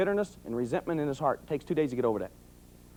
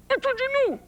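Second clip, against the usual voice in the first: through-the-teeth warning. Retold or imitated. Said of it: "You ain't gonna move your lips".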